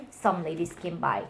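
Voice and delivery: ordinary delivery, nothing marked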